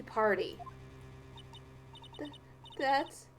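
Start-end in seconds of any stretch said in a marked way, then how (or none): 2.2-3.3 put-on higher, halting, scared young woman's voice; frightened witness. Frightened witness stammering to the defense attorney.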